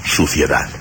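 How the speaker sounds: usual speaking voice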